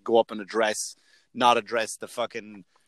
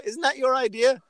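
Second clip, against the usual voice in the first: higher, put-on voice of people writing in; friends asking; 11 semitones higher, 4 dB louder than usual. Friends text the comic about a movie, higher teasing voice. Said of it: "I go".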